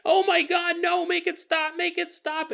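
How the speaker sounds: high, desperate begging to make it stop; mock anguished plea from a student; pleading scream. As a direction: beg in a shrill panic. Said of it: "we hear someone else go".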